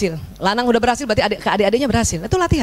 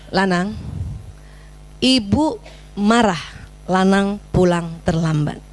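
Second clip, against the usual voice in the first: slow, low and measured scolding; firm reprimand; 5 semitones lower, much slower than usual. Shown as a demonstration. Mother reprimanding her son for coming home late, firm and slow. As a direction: spell out the displeasure slowly, without shouting. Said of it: "tegur perilakunya".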